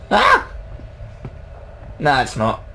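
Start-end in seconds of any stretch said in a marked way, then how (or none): 0.1-0.5 startled yelp. Real, not acted. Startled by scary house.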